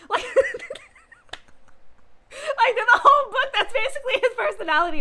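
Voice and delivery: high and loud, laughing through it. Real, not acted. Fan laughing while describing the sweet hero.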